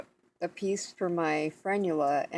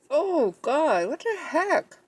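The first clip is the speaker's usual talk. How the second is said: startled pain; 8 semitones higher, slower than usual. Real, not acted. Pain from a new sore spot.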